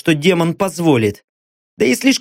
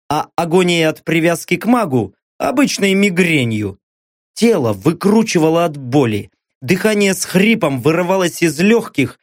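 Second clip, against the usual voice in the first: usual speaking voice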